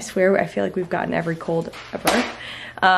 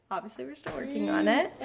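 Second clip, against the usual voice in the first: usual speaking voice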